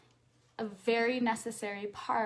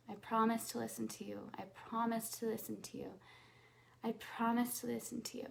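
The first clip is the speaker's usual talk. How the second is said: quick, much softer repetitions; soft fast repeat; 9 dB quieter, much faster than usual. Shown as a demonstration. Coach repeating promise to inner self, soft and fast. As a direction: murmur it quickly, over and over. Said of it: "repeated promise exercise".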